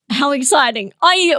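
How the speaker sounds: ordinary delivery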